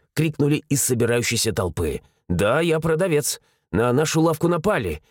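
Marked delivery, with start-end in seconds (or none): none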